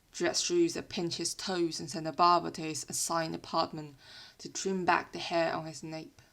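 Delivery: ordinary delivery, nothing marked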